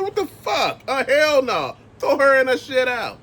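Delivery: high, shocked cry of disbelief; outraged outburst. Retold or imitated. Man picturing his sneakers torn up, outraged high cry. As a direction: blurt it out, appalled and indignant. Imagined reaction to ruined sneakers.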